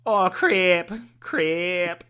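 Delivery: put-on high, loud Wisconsin accent; hammed-up Midwestern exclamation. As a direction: blurt it out like a Wisconsin girl. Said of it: "So what does that sound like?".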